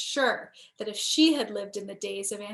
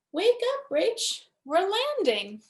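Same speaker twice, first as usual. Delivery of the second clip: higher, louder cheerful wake-up call; daddy waking her; 8 semitones higher, 3 dB louder than usual. Acted. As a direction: rouse a sleepy kid gently but brightly. Daddy waking sleeping Rachel.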